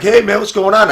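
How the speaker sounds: high, loud breezy greeting; mock the carefree friend, way up high; casual buddy. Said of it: "and he's like".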